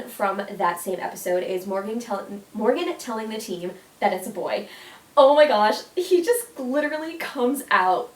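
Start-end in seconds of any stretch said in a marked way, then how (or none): 5.2-8.1 a loud, bright burst of delight; excited gush. Real, not acted. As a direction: gasp it out, overjoyed at the memory. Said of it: "it makes me cry".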